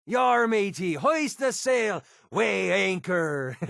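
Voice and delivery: high, drawn-out pirate cries; hammed-up pirate, stretching the words slowly; mock pirate. Mock pirate talk in a game.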